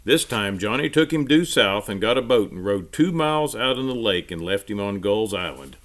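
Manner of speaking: usual speaking voice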